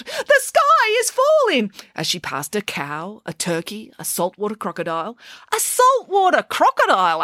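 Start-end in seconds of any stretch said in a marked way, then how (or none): 0.0-1.7 very high, slow wail of alarm; high-pitched, drawn-out storybook panic; panicked cry. A narrator's reading. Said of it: "continued to cry out". 5.5-7.2 high, astonished echo; a child interrupting the story, amazed; incredulous question. A narrator's reading. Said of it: "asked Michael".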